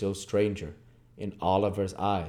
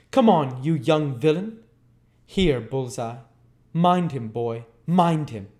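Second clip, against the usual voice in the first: raised, louder rough commands; brutal ruffian; 6 semitones higher, 6 dB louder than usual. Acted. Ruffian drags Oliver and urges his dog, loud. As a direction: bark orders at boy and dog.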